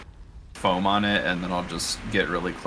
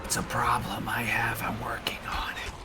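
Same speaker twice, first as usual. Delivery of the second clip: breathy mock ASMR whisper; whispered asmr role play; 4 dB quieter than usual.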